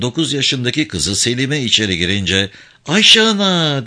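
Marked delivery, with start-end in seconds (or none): 2.9-3.9 put-on a child's long, loud call; high excited child's shout. A narrator's reading. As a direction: call out to her by name, drawn out. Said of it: "diye bağırarak".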